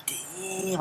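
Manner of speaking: high, soft impressed gasp; impressed damn. Retold or imitated. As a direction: breathe it out, amazed. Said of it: "Reggie was like".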